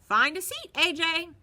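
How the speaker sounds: high, loud instruction; bus driver calling out. A narrator's reading. Bus driver tells AJ to sit, loud call. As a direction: call it down the aisle.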